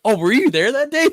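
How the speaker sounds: high, loud astonished question; mock-astonished, loud and high; surprised realization. Acted surprised reply in story.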